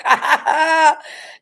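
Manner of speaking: bursts into high laughter; laughing. Real, not acted. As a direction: laugh out loud at the joke. Laughs at a viewer's joke.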